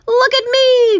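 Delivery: very high, loud squeal; high-pitched gleeful yell; excited cry. A narrator's reading. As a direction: cry out for attention, overjoyed. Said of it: "cried the centipede".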